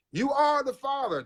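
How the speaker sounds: high, slow, drawn-out talk show host announcement; milk the big paternity reveal like a TV host; Maury Povich reveal. Retold or imitated. Telling the hosts about his DNA result, as a slow, high talk show host. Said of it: "came back later like Maury Povich".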